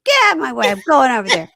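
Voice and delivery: high, loud, hammed-up squeal; mock drunken outburst. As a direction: barge through squealing like the drunk fiancée. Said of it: "So she's like".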